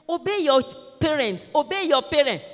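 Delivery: high, loud repeated instruction; preacher's command. Retold or imitated. Preacher telling children to obey parents, loud high command. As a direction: proclaim it firmly to the children. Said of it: "I said".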